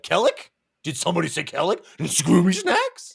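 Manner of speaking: high, excited cartoon voice; eager Salind. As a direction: perk up high as if offered treats. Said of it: "And Salind's like".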